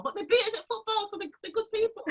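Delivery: high mock Liverpool voice; Scouse accent. Woman making peace with Liverpool viewers, mock Scouse accent. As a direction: lift the pitch into put-on Scouse. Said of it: "I'm a bit of a Scouser".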